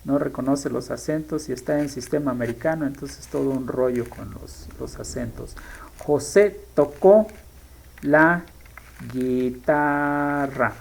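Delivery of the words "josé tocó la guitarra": slow dictation. Shown as a demonstration. Dictating while typing the sentence.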